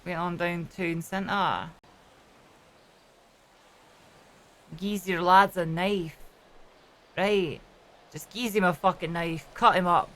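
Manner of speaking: thick Glaswegian hard-man impression; Glasgow accent. Shown as a demonstration. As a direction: talk like a Glasgow ruffian. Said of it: "It's Glasgow as fuck".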